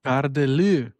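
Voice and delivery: Garde-lue said the French way, gardeh-loo; French phrase. Shown as a demonstration. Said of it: "in French a phrase".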